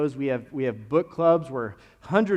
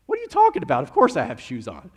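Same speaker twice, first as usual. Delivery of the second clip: indignant retort; 3 semitones higher, 8 dB louder, faster than usual. Retold or imitated. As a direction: blurt it back, incredulous and loud. Acted retort to shoe question.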